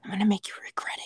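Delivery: breathy NPR-style near-whisper; close-mic menace. Pretend. Threatening Isaiah over a book club, close-mic murmur. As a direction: murmur a mock threat right on the microphone. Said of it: "NPR amount of close to the microphone".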